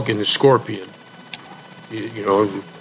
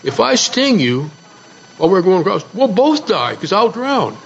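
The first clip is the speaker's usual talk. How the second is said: lightly voiced scorpion, pitched higher; a touch higher, reasoning with the frog; scorpion persuading; 5 semitones higher than usual. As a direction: argue the case as a scheming storybook scorpion. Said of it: "the scorpion says".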